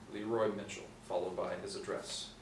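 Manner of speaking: normal talking voice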